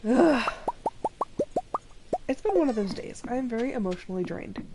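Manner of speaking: ordinary talk, nothing marked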